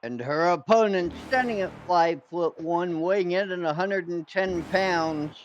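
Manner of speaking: lighter ring announcer intro. Acted. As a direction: announce the challenger to the crowd.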